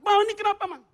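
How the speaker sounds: high, loud alarmed question; imitating a scared husband; frightened husband. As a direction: call out in mock panic. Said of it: "suami takut".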